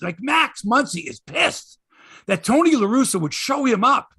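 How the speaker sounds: ordinary talk, nothing marked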